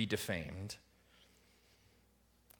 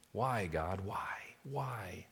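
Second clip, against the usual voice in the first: anguished why; 5 dB quieter, much slower than usual. Retold or imitated. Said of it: "struggle with fear and doubt amid tragedy".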